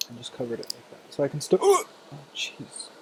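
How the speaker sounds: hiccuping mid-sentence; words broken by hiccups. Real, not acted. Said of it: "I have the hiccups".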